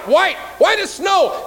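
high, loud and slow; shouted climax. Acted. Building to dramatic climax.

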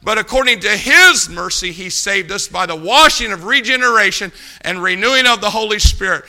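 punchy pulpit proclamation; forceful preaching. Real, not acted. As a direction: drive each phrase home to the congregation.

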